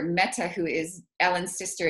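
ordinary talk, nothing marked

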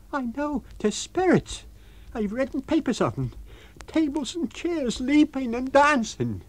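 shaken innkeeper's wife crying spirits; Mrs Hall, frightened landlady. Acted. Scared landlady telling her husband.

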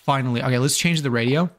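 ordinary talk

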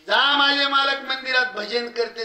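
रोखून बोलणे. Retold or imitated. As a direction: send him off as a defiant wife. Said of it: "पाटलाला रोखून बोलते". (ठाम, चढ्या आवाजात फटकारणे)

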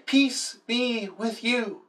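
a gentle slow blessing from Jesus; gentle peace greeting. Acted. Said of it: "He said it twice to the disciples".

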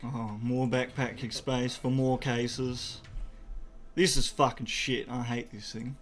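usual speaking voice

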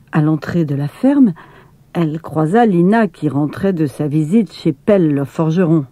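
ordinary delivery

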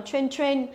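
"train" with a weak "tr" and short E; short learner's "train", no clear glide; wrong pronunciation demo. Shown as a demonstration. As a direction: mimic the mistaken "train" as "chwen". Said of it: "không đọc được cái chữ ter ở đầu".

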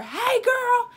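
high, loud, slow sassy neighbor call; neighbor lady. Retold or imitated. Neighbor across the street catching the sprayer, high loud sassy call. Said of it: "this lady says to her".

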